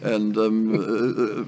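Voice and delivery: ordinary talk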